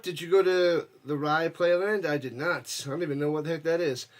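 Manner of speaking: ordinary delivery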